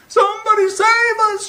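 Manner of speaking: very high, loud panicked cry; shrieking for help. Acted. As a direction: scream it in terror. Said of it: "shrieked the dogs".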